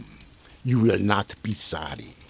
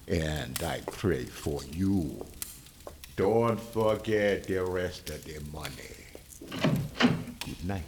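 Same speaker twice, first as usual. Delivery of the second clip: menacing hush; 6 semitones lower, 9 dB quieter, slower than usual. Acted. Sinister doctor leaving.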